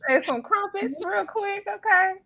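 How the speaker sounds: high, playful put-on British voice; hammed-up British tea-time invitation; mock British accent. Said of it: "I can't even do the accent".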